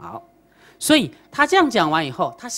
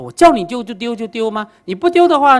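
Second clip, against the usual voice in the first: stern command; 6 semitones higher, 8 dB louder than usual. Retold or imitated. Master demands he throw it.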